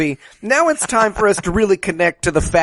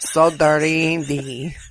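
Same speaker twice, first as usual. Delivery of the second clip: put-on slow, caricatured Mexican accent; hammed-up Mexican-accented English; insulting Mexican accent; 2 semitones lower, slower than usual. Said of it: "She has the most insulting accent".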